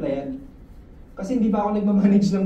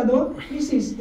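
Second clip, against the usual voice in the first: usual speaking voice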